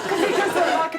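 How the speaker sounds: words through a laugh; giggling while explaining. Real, not acted. Laughing after the joke.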